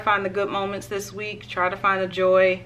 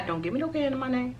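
ordinary talk, nothing marked

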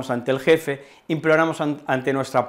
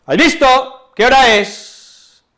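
"¿Has visto? ¿Qué hora es?" exclaimed high, loud and slow; exclaiming; 10 semitones higher, 9 dB louder, slower than usual. Shown as a demonstration. Teacher demonstrating an exclamation, "¿Qué hora es?", loud and slow. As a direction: call out each word with force. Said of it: "exclamó".

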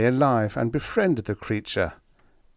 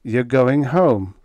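ordinary delivery, nothing marked

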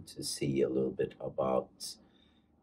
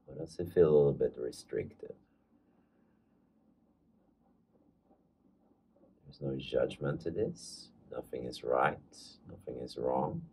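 calm meditation guide, leaving space between phrases; guided meditation; 4 dB quieter than usual. Acted. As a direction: ease listeners into following their breath, unhurried.

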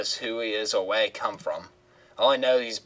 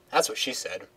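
ordinary delivery

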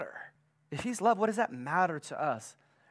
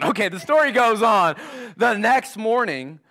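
energetic restart; 5 semitones higher, 6 dB louder than usual. Real, not acted. Preacher repeats with more energy.

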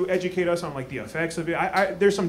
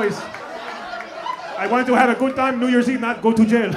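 put-on slow, raised African-accented protest; stressed Benga imitation; 4 semitones higher, slower than usual. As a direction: plead anxiously in a mock African accent. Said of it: "Homie is stressed right now".

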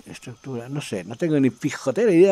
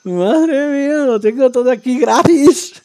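loud, high, thrilled exclamation; real excitement at a windfall; amazed excitement; 9 semitones higher, 6 dB louder, slower than usual. Real, not acted. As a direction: cry out in delighted disbelief. Amazed everything is free.

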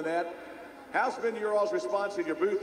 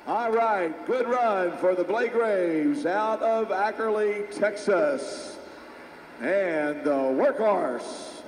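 live play-by-play; slower than usual. Real, not acted. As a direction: call the run as it happens.

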